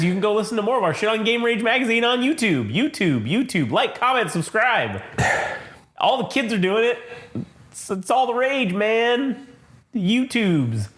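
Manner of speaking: mock promo. Plugging their channel.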